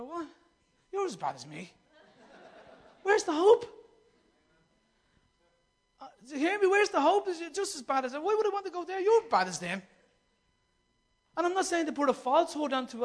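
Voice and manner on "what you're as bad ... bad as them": incredulous onlooker. Retold or imitated.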